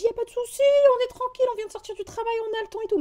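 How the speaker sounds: mock your own obliging reply in a high pitch; quick, high-pitched no-worries act; easygoing reply, imitated. Retells her own easygoing reply.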